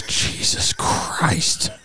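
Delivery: slow whispered mean dad; exasperated dad sigh. Pretend. Comedian doing mean dad in the elevator, whispered sigh. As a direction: breathe it out like a fed-up father. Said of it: "mean dad on vacation".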